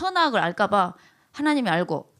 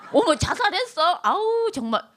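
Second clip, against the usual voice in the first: high-pitched "oh my" reaction; mock shock; 7 semitones higher than usual. Congregant hearing of a suicide, briefly shocked. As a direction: gasp out a passing dismay, pitched high.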